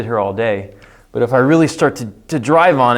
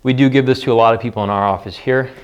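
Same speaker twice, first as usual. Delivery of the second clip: normal talking voice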